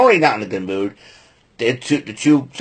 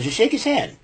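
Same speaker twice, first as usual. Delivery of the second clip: imitated high plea; 10 semitones higher, 6 dB quieter than usual. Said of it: "he goes".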